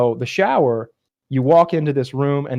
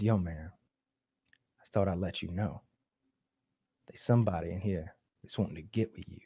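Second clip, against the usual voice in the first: low hushed voice, much softer; hushed warning; 5 semitones lower, 14 dB quieter than usual. Retold or imitated. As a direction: lean in and warn under your breath. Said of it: "He comes by and he goes".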